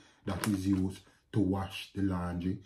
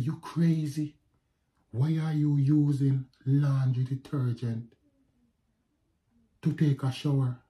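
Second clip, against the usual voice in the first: raised, higher mock scream of an alarmed wife; a louder, higher retold yell; 5 semitones higher, 5 dB louder than usual. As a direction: shout it like she's freaking out. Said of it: "she started screaming".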